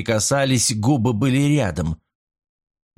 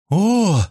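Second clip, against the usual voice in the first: high, rising-falling gasped "oh"; amazed gasp; 9 semitones higher, 4 dB louder than usual. A narrator's reading. Chelia feels the dragon's power rush through, amazed gasp. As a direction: gasp it out high and a little louder. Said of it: "ахнула Челия".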